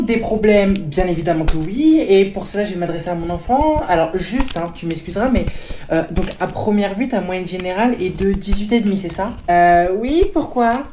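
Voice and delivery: loud, overbearing mom voice; loud pushy mother; loud mother character. Acted. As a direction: play the pushy mother booming over everyone.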